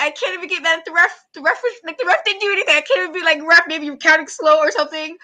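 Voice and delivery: high, loud exasperated venting; nobody to blame; frustrated rant. Retold or imitated. Fan venting that the ref isn't at fault, exasperated. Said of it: "And I was just like".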